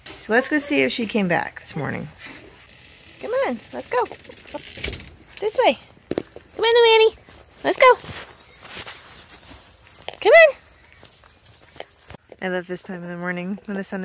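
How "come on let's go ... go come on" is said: high, rising coaxing calls; calling chickens. Real, not acted. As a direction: call the hen, higher and louder each time. Calling hen out of coop.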